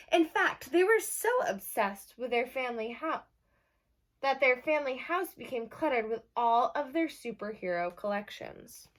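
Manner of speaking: lively read-aloud for a class; bright picture-book reading; reading to children. Acted. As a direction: announce the title like storytime. Teacher reads the story aloud.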